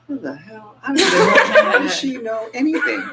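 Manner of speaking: high laughing astonishment; stunned, laughing at how she knows; laughing disbelief. Retold or imitated. Caller amazed she knows his sister, high laughing voice.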